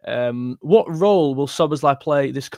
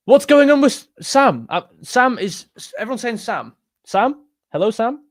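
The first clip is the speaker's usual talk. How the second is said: higher-pitched, baffled call to a viewer; puzzled calling out; 7 semitones higher, 5 dB louder than usual. Real, not acted. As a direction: call out as if nobody answers.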